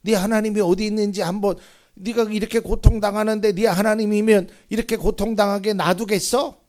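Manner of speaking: loud, high-pitched scoffing in a rush; mocking taunt. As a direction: imitate a jeering scoffer, hammering the challenge. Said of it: "그러면서 비웃는 거예요".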